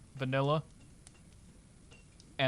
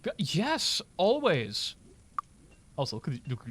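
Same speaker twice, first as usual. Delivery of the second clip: usual speaking voice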